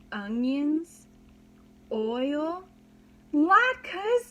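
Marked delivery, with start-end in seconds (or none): 3.3-4.3 high, loud alarmed cry; the penny drops with alarm; alarmed realization. Acted. Realizing the smell.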